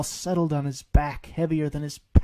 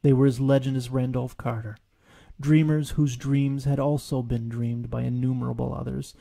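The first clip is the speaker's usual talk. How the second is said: ordinary delivery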